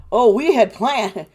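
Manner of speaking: loud, scornful mimicry; sarcastic imitation of a company excuse; mocking the excuse. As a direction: parrot the excuse with open contempt.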